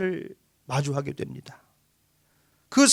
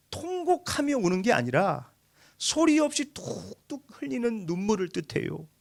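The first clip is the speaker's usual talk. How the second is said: ordinary talk, nothing marked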